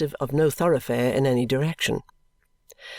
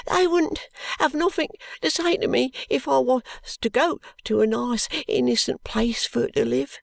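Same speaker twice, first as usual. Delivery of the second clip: put-on crying boy; 11 semitones higher than usual. A narrator's reading. Ragged boy pleading with the constable, high and crying. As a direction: wail it like a desperate ragged child. Joe answers.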